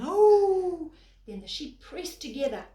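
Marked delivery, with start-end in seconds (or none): 0.0-0.9 very loud, high howl like a dog; howling call. Acted. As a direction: howl out to the flock. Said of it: "like a dog howling".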